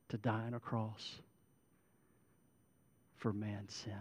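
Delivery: soft, low, pastoral tone; prayer invitation. Real, not acted. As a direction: gently lead bowed heads toward prayer, pausing often. Leads into closing prayer.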